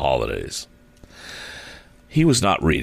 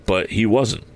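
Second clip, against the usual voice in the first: usual speaking voice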